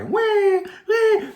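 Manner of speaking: high mock baby wail, wah wah; a playful fake baby crying; imitated baby cry.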